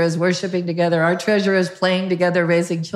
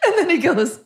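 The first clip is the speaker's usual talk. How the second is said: words squeezed out through a laugh, pitch jumping up; 12 semitones higher than usual. Real, not acted. Telling the hosts the story, laughing. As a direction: laugh as you start the line.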